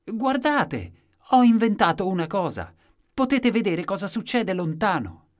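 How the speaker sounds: high, ringing announcement to a crowd; loud exclamation. A narrator's reading. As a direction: proclaim it insistently at the top of the voice. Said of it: "e l'inventore esclamava".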